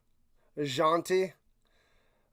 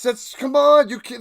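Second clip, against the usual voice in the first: normal talking voice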